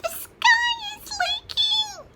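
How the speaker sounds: very high, slow, loud chick squeal; panicked peep. A narrator's reading. Smallest chicken panics at the rain, shrieking peep. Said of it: "she peeped".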